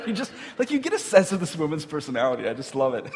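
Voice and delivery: high, laughing delight; amused and tickled, voice lifted by laughs. Real, not acted. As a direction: talk through real laughter. Amused by Martha's blunt remark.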